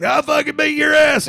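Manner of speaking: high, loud angry yell; imitated shouted threat. Guy threatening Bruce on stage, imitated shout.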